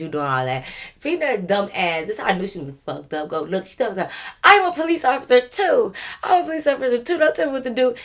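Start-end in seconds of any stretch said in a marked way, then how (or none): 4.4-7.9 much higher and louder insistence; mock dead-serious claim. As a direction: imitate her insisting in earnest. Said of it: "She didn't say it with a smile".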